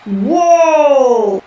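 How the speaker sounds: announcer screams at the flip. Acted. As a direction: gasp into the mic. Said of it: "the announcer screamed out".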